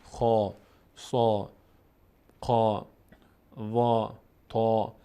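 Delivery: "خا، سا، قا، وا، تا" one by one, mouth opened upward, full vowel; full, heavy sound. Shown as a demonstration. Teacher shows learners heavy letters, "خا، سا، قا، وا، تا" said full. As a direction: say "خا، سا، قا، وا، تا" with a heavy, backed, rounded long aa.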